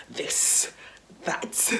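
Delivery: "this, that" as separate words, with th; self-correcting drill of "this, that"; practising th. Retold or imitated. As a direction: carefully sound th in "this, that", pausing between. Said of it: "had to go home and be like".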